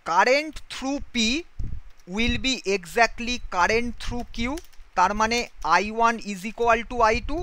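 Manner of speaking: slow dictation. Shown as a demonstration. Teacher dictating the condition.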